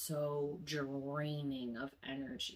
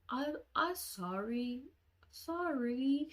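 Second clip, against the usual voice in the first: high, slow sheepish voice; little brother's apology; 9 semitones higher, slower than usual. Retold or imitated. Brother apologizing to crying girl, high slow sheepish voice. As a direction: imitate a hesitant kid, pitched up.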